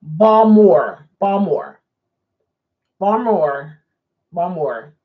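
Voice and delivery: two-syllable Baltimore, "Bawmore". Retold or imitated. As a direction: leave out the T and I in Baltimore. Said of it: "they don't have the T and the I".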